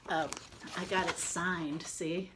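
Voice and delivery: ordinary delivery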